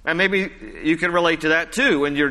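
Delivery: ordinary talk, nothing marked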